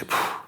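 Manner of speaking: imitated sigh of dismay; breathy "phew" of someone daunted; mock exasperated puff. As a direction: puff out air as if stumped. Voicing the listener's baffled reaction.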